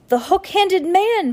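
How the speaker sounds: high, loud cry of alarm; shouted. A narrator's reading. Violet recognizing the fake doctor, alarmed shout. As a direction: shout it out high, drawing the words out. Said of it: "Violet shouted".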